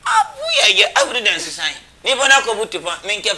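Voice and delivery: high outcry dropping into loud argument; exclaiming loud protest. Real, not acted. Guest told to give evidence, loud high protest. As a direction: burst out loudly, then keep arguing.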